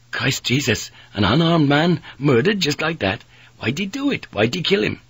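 high, shocked disbelief; aghast. Acted. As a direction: pitch climbing as you demand a reason. Sailor aghast at the killing.

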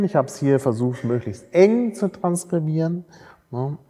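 usual speaking voice

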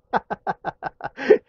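a short high giggle; stifled laugh. Real, not acted. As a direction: snicker after the call drops. Prank caller laughs after hanging up.

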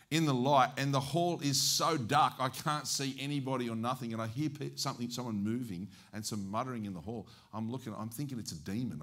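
ordinary delivery

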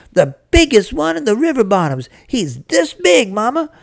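high, eager bragging; excited boasting boy. Acted. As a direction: impress Mama with the huge catch. Boy boasts about the coon.

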